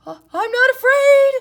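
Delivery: very high, loud frightened cry; scared cry. Acted. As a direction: insist he's not afraid while terrified. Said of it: "he cried".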